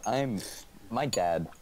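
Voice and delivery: normal talking voice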